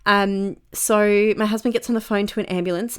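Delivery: ordinary talk, nothing marked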